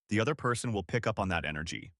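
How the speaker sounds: normal talking voice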